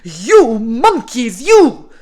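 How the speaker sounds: loud, slow, high furious shouting; angry shout. A narrator's reading. Peddler stamping his feet at monkeys, shouting angrily. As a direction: shout each word with full force. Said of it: "He stamped his feet and shouted".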